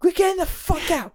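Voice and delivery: imitating a screaming father; mock scream. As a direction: yell like a dad losing it. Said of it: "he just starts screaming at his kids".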